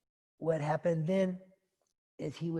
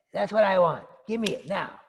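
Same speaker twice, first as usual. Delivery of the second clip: loud, high, bratty insistence; insistent demand; 6 semitones higher, 10 dB louder than usual. Acted. Siddhartha demanding no death from his father, insistent. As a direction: demand it forcefully like an impatient child.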